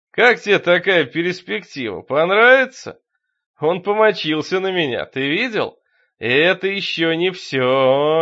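loud, slow, raving madman; possessed deacon, fanatical. A narrator's reading. Possessed deacon tells the priest of his master, raving loudly. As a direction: build to a stretched-out fanatical shout.

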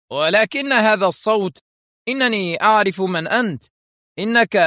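high-pitched astonished exclamation; stunned cry. A narrator's reading. Isaac recognising the masked man's voice, astonished. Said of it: "هاتفا في ذهول".

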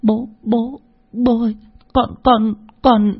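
put-on a scared little boy stuttering at his angry father; frightened boy's high stammer. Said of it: "sợ hãi lắp bắp".

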